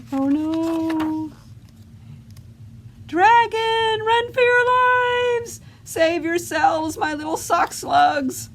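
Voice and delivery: panicked cry. Acted. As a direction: warn everyone to flee the dragon. Goblin panics at dragon.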